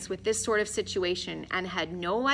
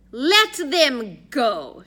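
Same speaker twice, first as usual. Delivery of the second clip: very slow, loud, higher-pitched order; grand, raised voice of authority; commanding announcement; 5 semitones higher, 8 dB louder, much slower than usual. A narrator's reading. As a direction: draw out each word imperiously. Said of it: "she announced".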